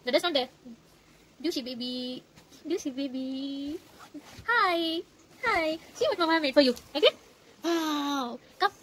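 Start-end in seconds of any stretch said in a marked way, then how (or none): 1.4-3.8 soft waking call. Real, not acted. Gently waking sleeping toddler. 4.5-8.7 cheerful voice for a little one. Real, not acted. Greeting child, high baby talk.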